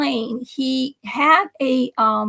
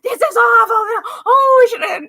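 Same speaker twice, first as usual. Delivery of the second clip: high, loud wailing lament; a mother wailing in dismay; 11 semitones higher, 5 dB louder than usual. Retold or imitated. Mother wailing at being late to the party. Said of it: "my mother was a doom".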